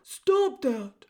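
big booming jailer's shout; booming male voice. A narrator's reading. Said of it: "boomed a voice".

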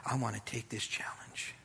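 hushed, earnest whisper; whispered resolve. Retold or imitated. A churchgoer quietly telling the pastor they accept the challenge. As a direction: breathe the commitment quietly. Congregant voiced.

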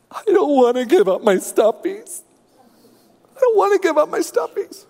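put-on high whiny little girl crying; mock toddler wail. Three-year-old daughter wailing to dad, high and whiny. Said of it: "she's bawling tears".

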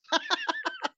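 a sudden high-pitched burst of laughter; high squealing laugh. Real, not acted. Laughs at friend's rant.

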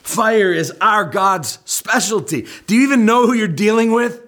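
cocky Baal prophet scoffing at Elijah; mocking taunt. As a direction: sneer at the prophet like a bully. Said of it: "The prophets of Baal were thinking".

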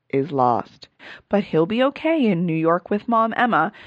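sing-song rhyming poem reading; rhyming recap. Acted. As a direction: read it like a playful verse. Reads rhyming episode summary.

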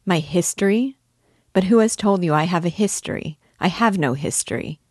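ordinary delivery

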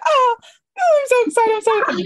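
high, loud thrilled squeal; breathless overjoyed outburst; so excited. Shown as a demonstration. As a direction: gush like fans arriving in Vegas to meet you. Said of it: "Be like".

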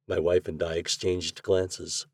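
plain reading voice

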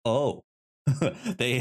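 ordinary talk

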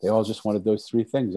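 ordinary delivery

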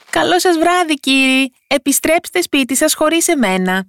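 high-pitched tiny taunting voice; cheeky, high-pitched farewell; mocking little fellow. A narrator's reading. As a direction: tease them in a high, small voice. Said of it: "άρχισε να γελάει κοροϊδευτικά".

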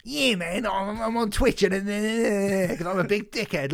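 exaggerated Cockney knobhead voice; mock Cockney. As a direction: put on a silly Cockney streamer accent. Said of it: "like, Cockney knobhead".

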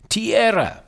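high, loud cry from the crow's nest; lookout's shout. Acted. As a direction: call it out high across the ship. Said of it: "The guy in the crow's nest of the Santa Maria shouting".

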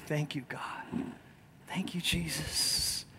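softly prayed thanks; hushed grateful prayer. Shown as a demonstration. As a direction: breathe it out as a quiet thank-you.

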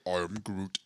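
low, soft mock Groot. Shown as a demonstration. Host explains his trick, low Groot line. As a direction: rumble it low as a warm-up. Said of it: "if I just go".

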